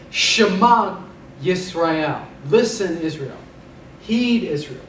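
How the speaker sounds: Shema Yisrael, drawn out slowly; slow, weighty verse reading; slow solemn recitation. Shown as a demonstration. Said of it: "the most important verse".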